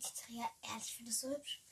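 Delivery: quick soft whisper. Real, not acted. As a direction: whisper it like a confession. Whispered confession.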